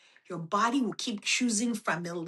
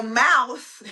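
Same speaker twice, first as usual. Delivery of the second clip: laughing; 5 semitones higher, 5 dB louder than usual. Real, not acted. Laughing at own example.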